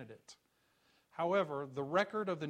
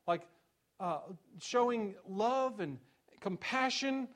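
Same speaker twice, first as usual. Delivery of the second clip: higher and louder, driving each question home; preaching at full force; 8 semitones higher, 5 dB louder than usual. Acted. Pastor pressing the congregation with questions, preaching at full force. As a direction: pound the rhetorical questions from the pulpit. Preaching about what to emphasize.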